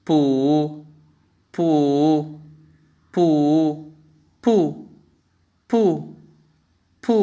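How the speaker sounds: पू in first, second, third, then fourth tone, each said with care; Mandarin bu four tones. Shown as a demonstration. Teacher leads students to repeat bu in all four tones. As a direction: sing पू through level, rising, dipping and falling tones. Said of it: "जैसे मैं पढ़ता हूँ आप भी मेरे साथ पढ़िए".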